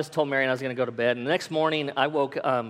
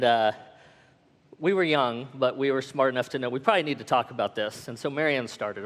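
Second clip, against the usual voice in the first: normal talking voice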